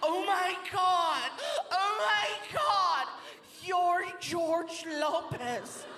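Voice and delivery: high, slow drawn-out shriek; starstruck dancer. Acted. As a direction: freak out at meeting a star. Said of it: "She's like".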